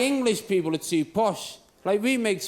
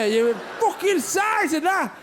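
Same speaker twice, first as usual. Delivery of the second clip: a rowdy fan whooping over a tiny cup; mock fan shout; 8 semitones higher than usual. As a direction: bellow it like a fan hoisting a trophy. Mocking Irish fans celebrating.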